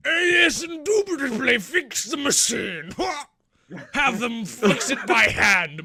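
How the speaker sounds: high, loud, drawn-out pompous lord; hammed-up haughty aristocrat barking orders; bigger aristocrat. Boss on the radio about a broken machine, overblown aristocrat. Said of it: "sound like an even bigger aristocrat".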